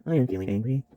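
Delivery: monotone, emotionless machine reading; deadpan computer monotone, no feeling; monotone robot. Shown as a demonstration. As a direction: say it softly on one even note, like text-to-speech. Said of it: "but it's monotone".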